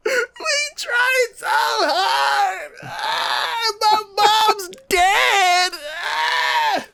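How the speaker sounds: high, slow, wailing melodramatic sob; mock sobbing. Retelling the main character breaking down, hammed-up wailing sob. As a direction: ham up a tearful breakdown. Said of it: "the main character who's been like".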